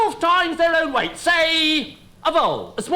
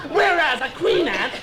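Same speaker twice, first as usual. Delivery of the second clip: ordinary delivery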